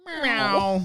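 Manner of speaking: high, loud snail meow; Gary meow. Retold or imitated. Friends doing SpongeBob voices, snail pet meowing.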